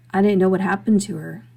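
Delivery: ordinary delivery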